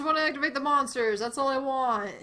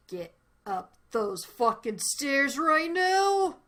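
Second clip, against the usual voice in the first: yelling orders, drawn out; shouting; 3 semitones higher, 4 dB louder, slower than usual. Real, not acted. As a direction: bellow it angrily. Shouting at game character.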